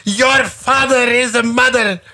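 high, laughing, outraged comeback; hammed-up furious reply; a Pakistani guy firing back in mock outrage; mock outraged retort. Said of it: "He would".

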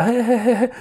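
high, imitated nervous giggle; nervous laugh. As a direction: mimic an uneasy little "he, he, he". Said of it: "begynder bare at blive mere og mere nervøs". (efterlignet nervøst grin)

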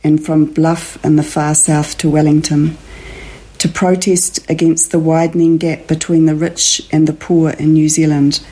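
slow, measured reading of a prose poem with long pauses. Acted. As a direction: read it like a solemn poem and let each image land. Author reads her poetic prose.

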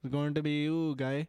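put-on Indian-accented playful threat. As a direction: Russell Peters style Indian accent. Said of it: "the Indian comes out".

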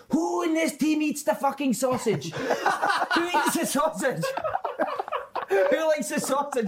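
high, slow shout, laughing through it; the angry coach yelling at players on the bus, mock furious; retold shout. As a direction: bellow it like a fuming manager, cracking up as you go. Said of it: "That's what he's shouting".

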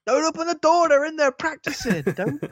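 high, put-on whiny voice; squeaky mock-petulant plea; high silly voice. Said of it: "high put-on voice quote".

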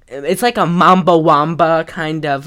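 ordinary delivery

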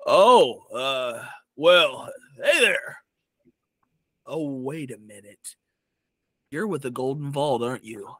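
a hint of a big man recognizing someone; hulking Goliath. Goliath figure recognizes the Golden Vault's agent, lightly voiced hulking figure. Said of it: "hulking Goliath figure steps in and goes".

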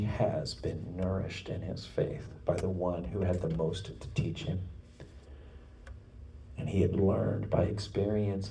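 soft, low, reverent narration; hushed preaching. Acted. Preacher telling how the disciple learned from Jesus, hushed preaching. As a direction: drop to a hush, near whisper in places.

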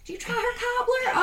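put-on Southern accent. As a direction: play a chatty Southern church lady in a Southern accent. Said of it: "I love speaking in a Southern accent".

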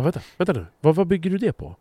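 baffled reply to being called angry; taken aback. Retold or imitated. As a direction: stop them short, baffled by the charge. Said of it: "Jag bara".

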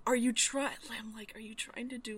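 hushed mock question to the narrator; muttered aside. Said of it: "'cause I'm like".

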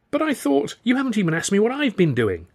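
high-pitched, aggrieved objection; indignant protest. A narrator's reading. Quarrel, she cuts off his protest.

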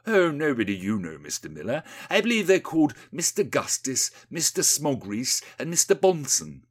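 smug politician. Acted. Rees-Mogg fobbing off Windy, mildly smug. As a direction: toss it off, then announce the names.

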